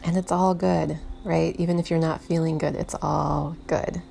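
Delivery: gentle meditation voice with long, unhurried pauses; soothing meditation guide, letting each instruction settle; guided meditation. Real, not acted. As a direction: ease the listener inward, leaving space between phrases.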